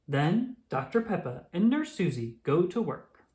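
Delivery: usual speaking voice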